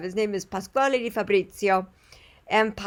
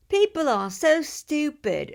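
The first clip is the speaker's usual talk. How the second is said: slow, higher British-accented imitation; 8 semitones higher, slower than usual. Imitating her knife sharpener's grumble, British accent. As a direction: put on your friend's British accent. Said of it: "he's got a really great accent".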